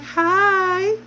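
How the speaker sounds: put-on high little baby "Hi" in a different voice; playful imitation of her son saying hi; imitating baby. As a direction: mimic the baby's tiny hello. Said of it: "He'd be like".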